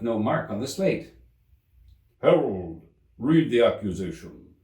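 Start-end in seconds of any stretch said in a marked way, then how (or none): 2.2-4.5 the king. A narrator's reading. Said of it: "said the king".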